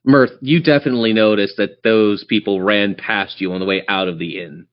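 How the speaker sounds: ordinary delivery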